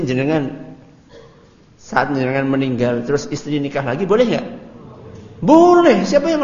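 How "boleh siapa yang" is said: high, loud exclaimed answer; loud, high answer to his own question; emphatic yes. Real, not acted.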